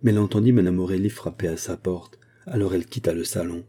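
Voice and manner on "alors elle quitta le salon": ordinary delivery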